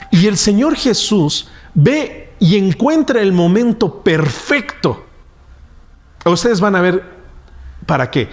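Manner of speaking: usual speaking voice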